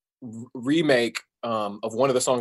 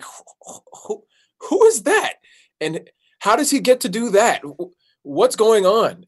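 high, wide-eyed disbelief, acted out; retold amazement; 9 semitones higher, 4 dB louder than usual. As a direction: start breathless, then burst out loud and high, stunned. Retelling amazement at DJ on TV.

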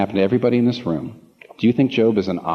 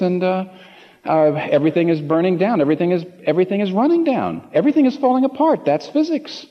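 rising mock alarm; 8 semitones higher than usual. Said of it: "You're gonna say".

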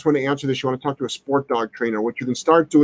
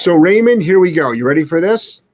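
hyped up; 4 semitones higher, 5 dB louder than usual. Real, not acted. Building up to blunt advice.

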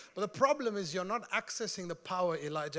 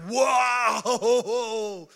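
mock awe; 6 dB louder than usual. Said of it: "We think that Elijah the prophet is like".

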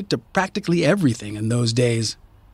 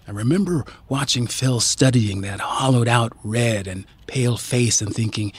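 ordinary delivery, nothing marked

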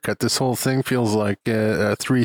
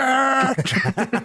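laughing; 13 semitones higher than usual. Real, not acted. Laughs after his impression.

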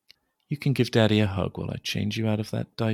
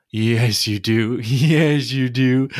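louder, higher giggly excitement; playful tickling; 4 semitones higher, 6 dB louder than usual. Acted. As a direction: giggle and squeal through the tickling. Tickle game, laughing.

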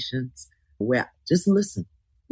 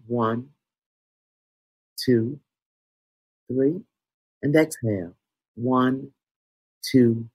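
guided meditation. Acted. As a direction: guide them inward, gently paced.

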